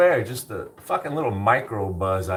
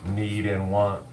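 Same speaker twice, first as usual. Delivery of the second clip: ordinary talk, nothing marked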